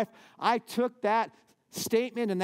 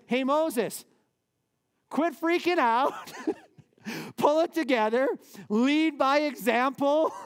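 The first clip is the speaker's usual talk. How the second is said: higher, louder, half-laughing mock scolding; God scolding Moses; 8 semitones higher, 4 dB louder, slower than usual. God telling Moses to quit freaking out, playful scolding. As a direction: tease Moses like an exasperated boss, slowly.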